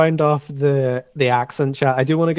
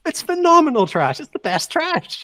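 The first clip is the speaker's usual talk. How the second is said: much higher, slow gushing over trash; mock gushing; 11 semitones higher than usual. Real, not acted. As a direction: rave about it like it's treasure. Gushing over trashy reality show.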